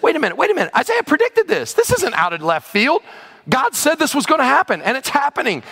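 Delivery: high-pitched, excited imitation of startled readers; astonished realization. Exiles realizing Isaiah predicted this, excited astonishment.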